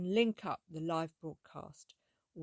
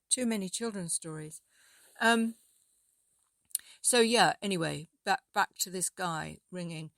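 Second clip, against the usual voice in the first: usual speaking voice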